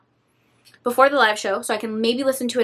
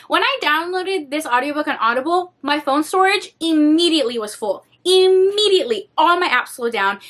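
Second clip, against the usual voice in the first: ordinary talk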